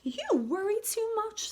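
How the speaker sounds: high, chiding mother; mother's voice. A narrator's reading. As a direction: scold gently like a fussing mum. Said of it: "said her mother".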